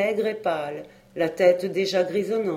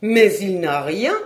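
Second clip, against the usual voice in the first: mild dismayed exclamation on "rien", louder and slow; "rien" lifted in worried surprise; wife dismayed at the child's empty bundle; exclaiming; 7 dB louder than usual. A narrator's reading. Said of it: "s'écria la femme".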